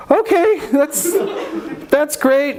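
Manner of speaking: mock shock at hearing about stitching corpses; stunned squeak. Very high stunned reply.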